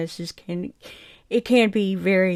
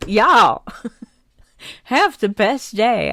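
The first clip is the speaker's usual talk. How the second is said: southern drawl; 4 semitones higher, 8 dB louder, much slower than usual. Real, not acted. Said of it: "that southern drawl came out right there".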